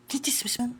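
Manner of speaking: whispered tease; hushed mock accusation. Real, not acted. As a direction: whisper it teasingly. Whispered teasing question.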